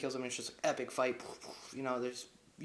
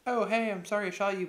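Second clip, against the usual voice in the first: higher, louder, chirpy put-on apology; pitched-up impression of the woman; mock woman's voice; 7 semitones higher, 6 dB louder than usual. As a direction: imitate her breezy apology. Voicing woman in story.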